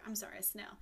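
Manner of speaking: ordinary talk, nothing marked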